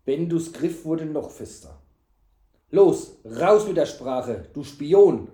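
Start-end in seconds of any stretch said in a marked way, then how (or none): 2.7-5.3 loud, slow, gruff order. A narrator's reading. Knight interrogating suspected spy, gruff. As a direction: bark it at the spy, much louder. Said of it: "sagte er barsch".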